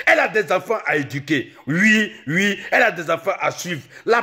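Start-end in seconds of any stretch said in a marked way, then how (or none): none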